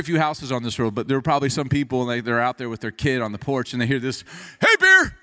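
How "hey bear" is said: high, full-voiced warning shout; shouting high and loud into the dark; loud yell. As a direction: yell out to scare off a bear. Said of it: "I'm talking real loud".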